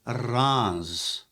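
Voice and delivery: Reims said slowly as "rahnz"; "Reims" as "rahnz", ignoring the spelling; French pronunciation. Shown as a demonstration. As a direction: give Reims its French sound, one drawn-out "rahnz". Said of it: "the French pronounce it".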